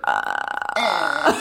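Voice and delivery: creaky croaked ah; vocal fry. Retold or imitated. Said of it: "I just hear".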